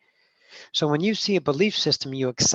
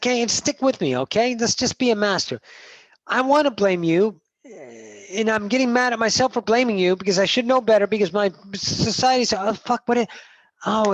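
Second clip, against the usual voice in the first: loud, higher-pitched acted venting; retold angry yelling; 5 semitones higher, 6 dB louder than usual. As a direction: re-enact venting at someone, worked up and loud. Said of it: "I fucking yelled at a couple of people".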